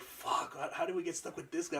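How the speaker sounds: hushed, fast whisper; whispered complaint. Retold or imitated. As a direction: mutter it under your breath quickly.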